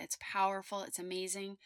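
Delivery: usual speaking voice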